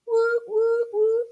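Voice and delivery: three high, level woos; excited whoop. Real, not acted. Celebrates the counted pattern.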